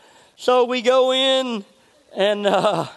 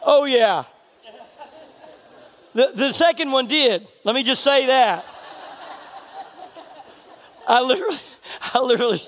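laughing; 3 semitones higher than usual. Real, not acted. Laughing at own punchline.

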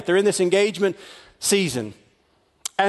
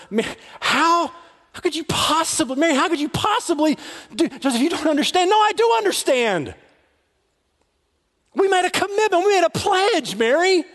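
much higher, rising, hurt anger; anguished outburst; 11 semitones higher than usual. Acted. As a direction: cry out at her in betrayal. Joseph erupts in anguish.

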